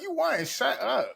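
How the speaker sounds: very high-pitched mock telling-off; retold exasperation. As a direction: squeal it in disbelief, as a retold reaction.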